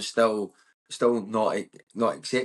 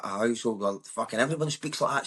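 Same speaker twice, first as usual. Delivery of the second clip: ordinary delivery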